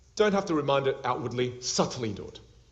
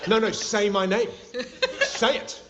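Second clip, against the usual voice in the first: mock insistent; 8 semitones higher, 4 dB louder, slower than usual. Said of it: "you're like".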